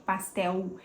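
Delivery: "pastel" with its end said like a u, the wrong way; wrong vowel-like l. Shown as a demonstration. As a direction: show the u-like l. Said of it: "Pra não falar com U". ("pastel" com L soando como U)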